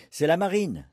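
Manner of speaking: high, ringing call that drops at the end; jubilant, high-pitched hail from the doorway; joyful announcement. A narrator's reading. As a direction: burst out happily on coming home.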